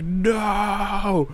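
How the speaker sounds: long, high, drawn-out mock wail; dramatic movie cry. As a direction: draw it out like a slow-motion movie no. Mimics Lando's slow-motion despair.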